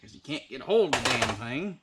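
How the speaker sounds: ordinary talk, nothing marked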